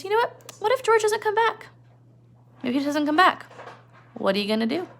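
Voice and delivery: high teasing taunt, voiced up; Crooks voiced high. Crooks teasing Lenny about George, in a high sly voice. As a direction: taunt him in a lifted, sly voice. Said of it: "He says".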